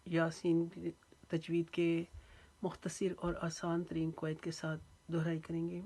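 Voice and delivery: ordinary talk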